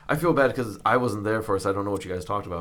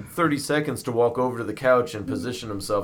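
ordinary talk, nothing marked

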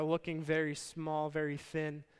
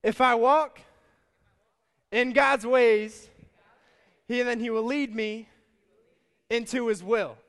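call-and-response; 5 semitones higher, 10 dB louder than usual. Shown as a demonstration. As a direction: lead the crowd line by line. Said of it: "say this out loud with me".

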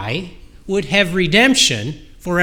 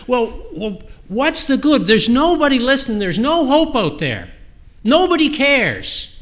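higher-pitched imitation of someone giving up; despairing complaint, retold; 6 semitones higher than usual. Preacher retells someone's hopelessness to the congregation, exasperated mock despair. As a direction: act out a hopeless protest, pitched up.

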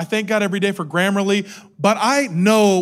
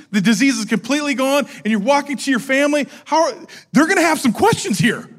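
ordinary delivery

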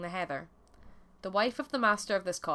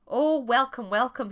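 higher, louder cry of welcome; gleeful cry; 5 semitones higher, 6 dB louder, slower than usual. A narrator's reading. Hungry fox greets the fallen bannock, gleeful cry. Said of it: "he cried out".

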